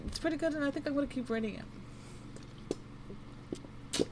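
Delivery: rushed, fast, all in one breath; talking fast. Real, not acted. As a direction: rattle it off quickly. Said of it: "sorry, I'm talking fast".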